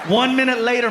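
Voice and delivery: normal talking voice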